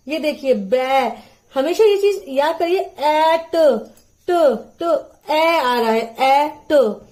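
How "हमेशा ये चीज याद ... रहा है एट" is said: louder, stressing each sound of "एट"; emphatic phonics. Shown as a demonstration. Teacher urging parents to focus on "एट" sounds. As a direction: hammer home "ट, ट" and "एट". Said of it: "इसका pronunciation बच्चे के लिए आप जरूर focus करिएगा".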